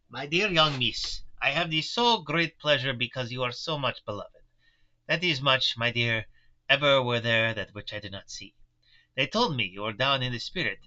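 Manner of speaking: put-on Van Helsing in a German accent; old professor, German accent, gentle and kind. Professor comforts the pale young patient, German accent. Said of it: "you need a dialect coach".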